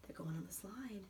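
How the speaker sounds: soft excited whisper. Real, not acted. Mother whispering to child about the slide. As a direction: hush it with wonder.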